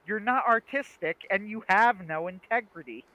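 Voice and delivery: half-yelled, emphatic accusation; retold yelling. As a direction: imitate someone yelling at his friend. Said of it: "like, yelling at him".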